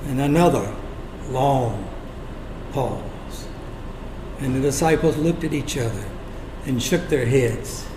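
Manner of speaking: low, soft and halting; hushed suspense. A narrator's reading. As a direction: let each word hang in the silence. Stretching out the second silence.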